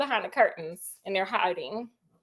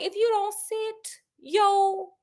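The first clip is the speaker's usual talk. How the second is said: stern threat; 8 semitones higher than usual. Retold or imitated. Sherlock holding Watson back behind the curtain, stern and threatening. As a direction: hold your friend back with a threat. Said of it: "Sherlock grabbed him and was like".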